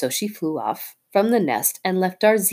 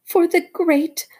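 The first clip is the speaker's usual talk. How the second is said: fawning little bird. Acted. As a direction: coo like a bird praising its hero. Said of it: "said Darzee".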